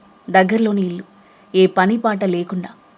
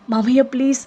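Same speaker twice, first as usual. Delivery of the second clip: tearful plea; 6 semitones higher, faster than usual. Acted. Aruhi thrown out, begging uncle.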